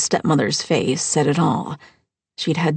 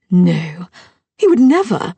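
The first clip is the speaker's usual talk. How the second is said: shocked denial; 6 dB louder than usual. Acted. Mother-in-law shocked denial.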